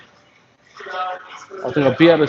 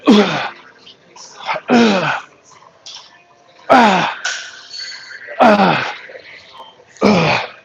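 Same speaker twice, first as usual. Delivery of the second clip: pained groan; 7 semitones higher, much slower than usual. Real, not acted. Exhales after set.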